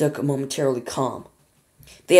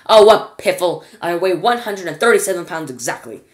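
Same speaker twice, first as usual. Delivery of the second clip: put-on indignant old lady; 2 semitones higher, 7 dB louder than usual. Georgina scoffs at weightlessness.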